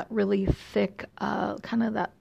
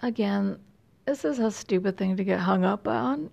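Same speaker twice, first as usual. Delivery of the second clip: ordinary delivery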